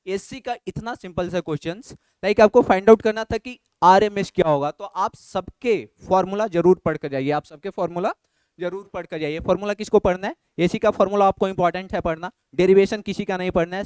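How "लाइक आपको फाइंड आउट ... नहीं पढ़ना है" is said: much louder, insistent advice; emphatic teacher. Real, not acted. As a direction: drive the point home loudly to students. (ज़ोरदार, ऊँची आवाज़ में समझाना)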